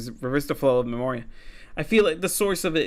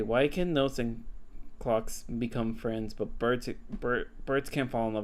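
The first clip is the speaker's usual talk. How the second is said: soft puzzled reread; 4 semitones lower, 7 dB quieter than usual. Acted. Rereads bird's question, puzzled.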